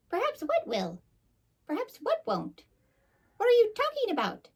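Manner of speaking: very high, quick squeaky squirrel asking. Acted. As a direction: squeak out the questions like a little squirrel.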